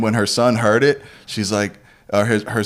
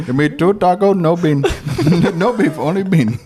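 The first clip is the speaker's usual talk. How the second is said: put-on Indian accent; 7 semitones higher than usual. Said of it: "every Indian would be like".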